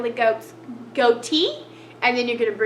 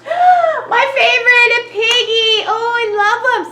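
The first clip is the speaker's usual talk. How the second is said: delighted gasp; 9 semitones higher, 6 dB louder than usual. Real, not acted. Excited about piggy picture.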